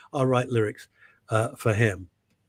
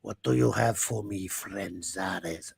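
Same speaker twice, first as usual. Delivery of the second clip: film villain voice; 6 semitones lower, 6 dB quieter, much slower than usual. Retold or imitated. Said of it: "what was the name in Supergirl".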